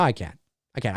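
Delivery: usual speaking voice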